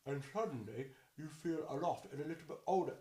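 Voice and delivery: a hint of someone older and aloof; jaw pulled back. Shown as a demonstration. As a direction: speak with the jaw tucked back. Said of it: "And then move it back".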